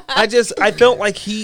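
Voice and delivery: laughter spilling into speech; laughing. Real, not acted. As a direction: giggle through the words. Laughing at the impression.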